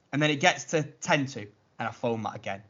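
ordinary delivery

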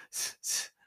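rhythmic unvoiced breaths; panting while running. Tomohiro jogging along the highway, rhythmic panting. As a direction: breathe in-in, out-out as you jog.

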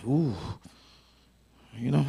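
usual speaking voice

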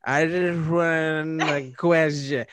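put-on slow, slurred drunk voice. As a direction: slur it like you're drunk. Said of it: "I thought I was gonna sound like that".

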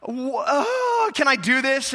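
high whimper easing into a worried question; hammed-up anguished wail, then easing off; mock heart-wrenching. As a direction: imitate a parent tearfully hesitating. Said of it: "it's like, it's heart-wrenching".

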